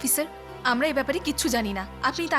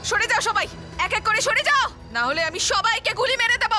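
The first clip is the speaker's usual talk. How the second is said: angry threat; 7 semitones higher than usual. Acted. Threatening with a gun.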